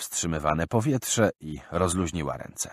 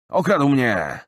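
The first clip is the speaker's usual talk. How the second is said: higher, louder outraged cry; indignant; 7 semitones higher, 6 dB louder, slower than usual. Acted. Robbed man accusing his companion, indignant. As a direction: spit out the accusation. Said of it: "powiedział z oburzeniem".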